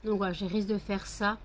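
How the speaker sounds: ordinary talk, nothing marked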